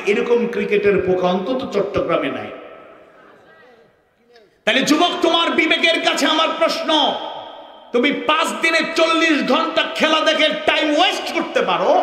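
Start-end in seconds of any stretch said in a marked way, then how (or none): 4.6-11.9 fiery challenge. Real, not acted. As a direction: call out the youth from the pulpit. Preacher challenging youth directly. (যুবকের প্রতি উচ্চস্বরে challenge)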